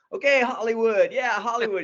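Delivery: high, loud mocking nickname; condescending. Surfer mocking the would-be actor as Hollywood, condescending. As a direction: talk down to the young actor. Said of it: "so condescending".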